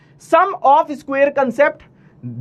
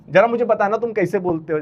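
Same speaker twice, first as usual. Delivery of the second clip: ordinary delivery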